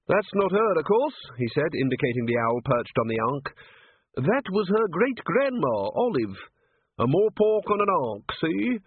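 put-on high, rustic old man's voice; chatty old yokel showing off his birds; lightly cracked old keeper voice; old zoo-keeper.

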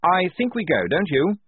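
high officer's voice; lieutenant's voice. A narrator's reading. Lieutenant suggesting the squad moves, high officer voice. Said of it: "said the lieutenant".

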